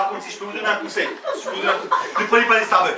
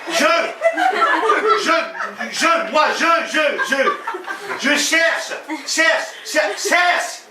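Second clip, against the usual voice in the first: parler fort à l'étranger; 6 semitones higher, much slower than usual. Shown as a demonstration. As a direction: hammer each word as if to someone who can't understand. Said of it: "tu lui parles fort". (parler fort et aigu, mot par mot)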